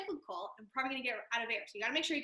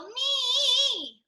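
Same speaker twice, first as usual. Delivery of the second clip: nasal me jumped way up and loud; 13 semitones higher, 10 dB louder than usual. Shown as a demonstration. Voice teacher shows a nasal meow, high. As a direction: pinch it through the nose like a cat. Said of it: "very nasal".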